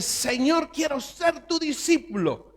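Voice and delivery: high, loud and earnest; eager young man. Retold or imitated. Rich young man pleading with Jesus to follow him, eager and high. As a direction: run up and offer yourself with zeal.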